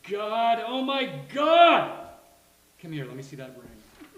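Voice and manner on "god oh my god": building to a high, loud cry; thrilled exclamation. Acted. Reacting to friend's engagement, mounting excited cry. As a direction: draw it out, louder the second time.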